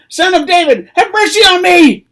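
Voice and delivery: very high, very loud desperate cry; all-out shouted plea. Acted. Blind beggar refusing to be silenced by the crowd, all-out shout. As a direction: bellow it at full force, pitched right up. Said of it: "he cried out even more loudly".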